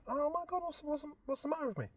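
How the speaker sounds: high, soft fast worried question; confused distress. Retold or imitated. Man lost in a dream airport, soft high anxious muttering. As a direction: fret at yourself, softly. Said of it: "high emotion".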